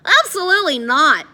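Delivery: high, loud, emphatic refusal; desperate refusal. A narrator's reading. Eddie refusing Addison's plan at the entrance, desperate. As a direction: refuse point-blank in a panic. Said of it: "Eddie shook his head desperately".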